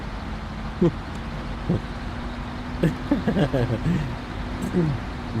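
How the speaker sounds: long soft airy laughter; breathy laugh. Real, not acted. Laughs at own quote.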